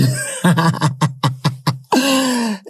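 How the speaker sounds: long warm laugh into the music. Real, not acted. Host laughing at her own Indomie joke to listeners, real laugh.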